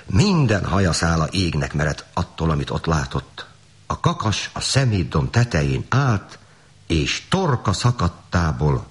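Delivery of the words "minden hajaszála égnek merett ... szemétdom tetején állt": ordinary delivery, nothing marked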